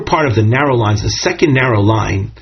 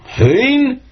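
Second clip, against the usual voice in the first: "Hein?" shot up high as an incredulous question; wondering hein; 11 semitones higher than usual. Shown as a demonstration. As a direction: say "Hein?" with an astonished, disbelieving rise. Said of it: "says with a tone of wonderment".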